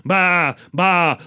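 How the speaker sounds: short, plain "ba" bleats; ordinary sheep bleat. Retold or imitated. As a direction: imitate a common sheep. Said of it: "all the other sheep, they go".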